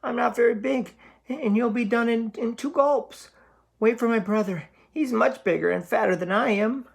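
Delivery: very high, stammering goat plea; wobbling with fear. Acted. Middle goat begging the troll not to eat him, high and stammering. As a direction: stutter and beg, terrified. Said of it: "wobbled where he stood".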